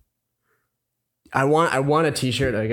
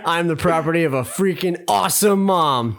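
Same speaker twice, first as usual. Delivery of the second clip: higher-pitched, deliberate slogan reading; dramatic reading; 5 semitones higher than usual. Acted. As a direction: declaim the shirt slogan with mock pride. Reads shirt slogan aloud.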